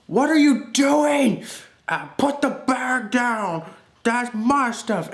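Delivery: high, slow mock yell; hammed-up shout at a thief; drawn-out, high-pitched scolding; indignant grandpa.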